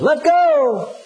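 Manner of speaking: high, calm two-word command; the sky answering simply; voice from above. Retold or imitated. Voice from the sky to the dangling man, lightly voiced. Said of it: "And the voice said".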